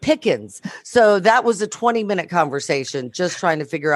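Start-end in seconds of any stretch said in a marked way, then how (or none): 0.0-0.6 "pickins" said PICK-inz; "pickins" as PICK-inz, for pecans; imitated local pronunciation. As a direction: say "pickins" slowly, with a short "pick". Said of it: "They've got pickins".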